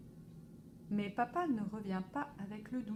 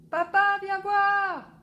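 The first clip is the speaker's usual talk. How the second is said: high, loud, slow call; scared boy calling papa to the cupboard; child shouting; 10 semitones higher, 13 dB louder, slower than usual. A narrator's reading. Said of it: "crie Alphonse".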